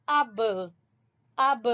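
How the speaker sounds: اب with a bouncing echo on the b; qalqalah. Shown as a demonstration. Teacher showing students qalqalah, bouncing the b of اب. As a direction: release the b of اب into a short extra sound. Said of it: "یہ قلقلہ ہے".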